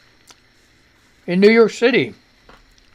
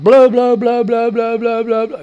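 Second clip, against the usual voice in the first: loud chant of blow, fading as it goes; mock coaching yell; 4 semitones higher, 7 dB louder than usual. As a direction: cheer them on like the test nurse, starting loud. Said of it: "All right, let it out".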